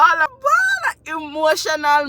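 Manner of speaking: high, breaking crying shout. Real, not acted. Telling chat she bawled, crying high. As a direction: bawl it out. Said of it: "Chat a ball".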